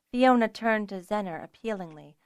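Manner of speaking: plain reading voice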